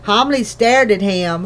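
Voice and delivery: plain reading voice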